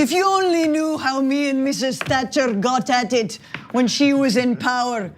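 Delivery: put-on a loose, drifting Scottish-ish British accent; vague British accent. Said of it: "I don't really know what accent I'm going there".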